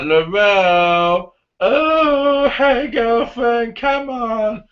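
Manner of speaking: very high, loud, drawn-out taunt; hammed-up bad guy luring his prey; mock villain calling. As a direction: stretch the names out like a creepy hunter. Bad guy calling to hidden girl.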